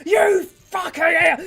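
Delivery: high-pitched furious heckle; raging yell. Acted. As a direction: shout abuse from the angry crowd. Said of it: "fully raging".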